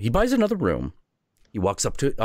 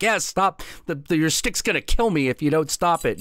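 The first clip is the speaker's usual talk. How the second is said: higher, panicky begging; pleading innkeeper; 8 semitones higher than usual. Acted. Innkeeper begging the little Viking, a lightly panicked plea. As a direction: beg for the beating to stop.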